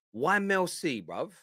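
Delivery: ordinary delivery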